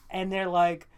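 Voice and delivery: usual speaking voice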